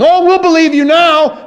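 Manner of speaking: high, loud sneering mocker's voice; a mocker in the crowd jeering at Jesus, high and loud; mocking taunt. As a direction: jeer it up at the cross like a scoffer. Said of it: "you hear the voice of the mocker saying".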